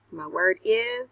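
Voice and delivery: ordinary talk, nothing marked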